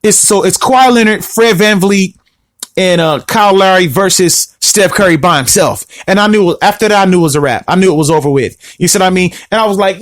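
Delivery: usual speaking voice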